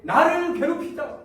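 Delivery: high, loud, slow repeat of the charge; mocking quote of God. Israelites mimicking God's accusation, high slow mockery. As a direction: throw God's words back. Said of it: "이렇게 따집니다".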